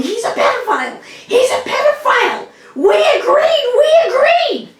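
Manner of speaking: frantic high-pitched accusing scream; retold screaming. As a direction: shriek it like a furious aunt bursting into the room. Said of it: "she's yelling and screaming".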